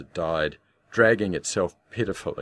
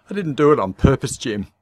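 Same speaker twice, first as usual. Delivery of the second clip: moaning; 8 semitones higher, 8 dB louder than usual. A narrator's reading. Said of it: "moaned yet again".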